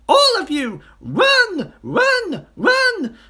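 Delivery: very high, loud monkey voice; Mugglewump shouting. A narrator's reading. Monkey warning children of a disguised crocodile, high-pitched shouting. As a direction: shout an urgent alarm. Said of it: "Mugglewump shouted to the children".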